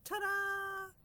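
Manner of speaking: high, loud cheerful announcement; bright flourish of a reveal; triumphant ta-da. Real, not acted. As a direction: present it like a magic trick. Triumphant reveal of good news.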